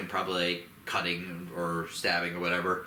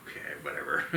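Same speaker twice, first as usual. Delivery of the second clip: lower, whispered brush-off; dismissive whisper; 7 semitones lower, 4 dB quieter, faster than usual. Retold or imitated. The Scar King shrugs off the laughter, whispered and dismissive. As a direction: breathe it out, unimpressed. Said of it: "he's like".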